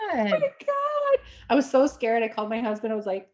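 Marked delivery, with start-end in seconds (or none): 0.1-1.2 high, drawn-out "oh my God"; pitched way up, aghast; retold shock. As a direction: stretch it out high in mock horror. Retold shocked reaction on the phone.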